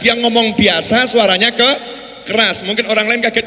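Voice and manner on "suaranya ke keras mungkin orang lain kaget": ordinary talk, nothing marked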